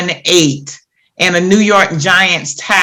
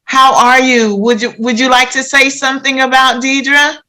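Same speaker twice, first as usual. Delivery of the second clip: raised, bright-pitched eager greeting; gushing welcome; 5 semitones higher than usual. Real, not acted. Host welcoming the missing girl's mother on air, eager and high. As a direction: greet her warmly and eagerly, pitch lifted.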